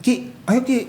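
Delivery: high-pitched retold invitation; coaxing invite. Friend inviting him to a Christmas service, coaxing. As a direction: imitate a friend urging him along. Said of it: "temen saya ngajak".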